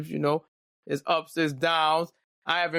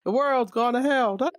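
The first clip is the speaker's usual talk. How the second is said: imagined co-host; 7 semitones higher than usual. Pretend. Imagined future co-host welcoming listeners, high and lively. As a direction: play the future co-host opening the show. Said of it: "And she'll be like".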